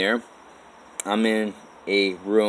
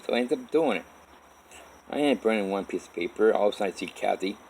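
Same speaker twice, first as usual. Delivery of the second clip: usual speaking voice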